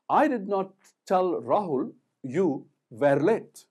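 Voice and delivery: slow, loud delivery leaning on "were"; stress on WERE. Shown as a demonstration. Teacher showing another emphasis, stress on "were". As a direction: set "were" apart and press on it. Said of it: "another emphasis on the word "were".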